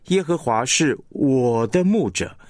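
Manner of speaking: 我 pushed out strongly in 耶和华是我的牧者; stress on 我. Shown as a demonstration. Teacher showing stress on 我 in the verse, emphatic. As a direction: make the 我 stand out. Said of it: "这个"我"是被强化了".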